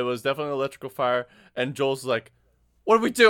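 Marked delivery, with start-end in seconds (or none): 2.9-3.3 loud, high mock-panicked cry; hammed-up frantic question; imitated panic. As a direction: act out a coworker panicking. Coworker's panic acted out.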